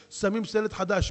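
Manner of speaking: ordinary talk, nothing marked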